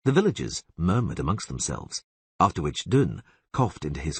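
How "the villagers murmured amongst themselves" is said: ordinary delivery, nothing marked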